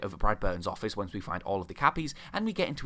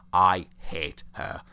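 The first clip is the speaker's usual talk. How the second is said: very slow, low, word by word; drawn-out, lower, heavy disgust; emphatic loathing; 4 semitones lower, 5 dB louder, much slower than usual. Real, not acted. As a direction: spell out your hatred slowly. Sums up loathing of character.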